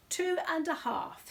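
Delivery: high, slow and firm bear voice; firm Paddington. Acted. Bear insists to the host, high and firm. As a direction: stand by the answer firmly. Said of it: "said Paddington firmly".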